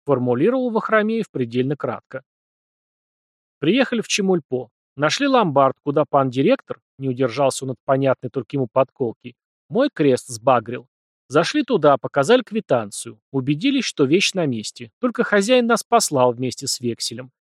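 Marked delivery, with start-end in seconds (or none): none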